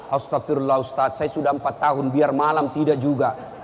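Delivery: low, subdued grumble of a congregant; weary complainer. Acted. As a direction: voice a discouraged man complaining, pitched right down.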